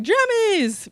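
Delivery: high, gleeful group cheer; mock cheer. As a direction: imitate a thrilled crowd on one word. Everybody cheers about the pajamas.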